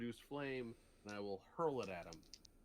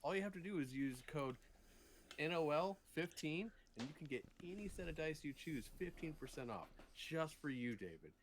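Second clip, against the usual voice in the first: animated, higher salesman pitch; sponsor ad read; 4 semitones higher than usual. Pretend. Pitching a dice sponsor to an unlucky player, ad read. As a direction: sell it like a live ad spot.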